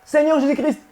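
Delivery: high mock-devout invocation, a little laugh; imitating the faithful. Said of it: "vous le dites comme ça".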